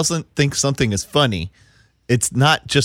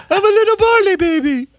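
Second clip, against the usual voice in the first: put-on very high baby-puppy squeak; little puppy falsetto; Barley dog voice. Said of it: "That's your dog voice?".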